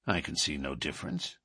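low, soft remark while examining; low murmur. Acted. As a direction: murmur it, absorbed in inspecting the coins. McGinty inspects the coins.

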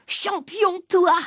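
high, incredulous jeer breaking into laughter; laughing mockery. A narrator's reading. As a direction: laugh in the rival's face. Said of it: "Papa s'est mis à rire".

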